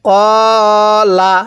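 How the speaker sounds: কলা with the first vowel held long and the last short; কলা with the ক stretched. Shown as a demonstration. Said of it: "কফের মধ্যে টান হবে".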